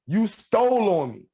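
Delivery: high, whiny "You stole on me."; a mocking high replay of "You stole on me."; mimicking complaint. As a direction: imitate the guy crying out "stole", high and falling. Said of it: "he said".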